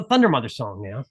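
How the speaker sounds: normal talking voice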